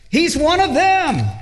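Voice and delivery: high, pointed accusation to the crowd; accusing servant girl. Retold or imitated. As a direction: point him out, high and sharp. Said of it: "and she says".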